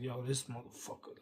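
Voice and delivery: soft, grumbled aside; under the breath. Retold or imitated. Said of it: "now I'm under my breath".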